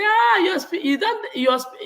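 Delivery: high-pitched outburst of catching someone out; children's exclamation. Retold or imitated. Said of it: "they go say".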